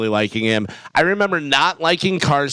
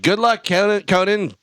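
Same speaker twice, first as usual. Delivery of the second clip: cheering passersby; 7 semitones higher than usual. Retold or imitated. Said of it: "everyone's like".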